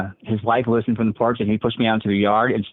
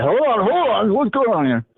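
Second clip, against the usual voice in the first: much higher, alarmed mock voice of the brother; imitating panicked brother; 12 semitones higher than usual. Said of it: "my brother came running and said".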